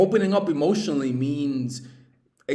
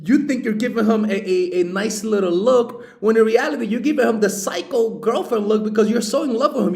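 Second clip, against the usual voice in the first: mocking lovestruck voice; 8 semitones higher, 6 dB louder than usual.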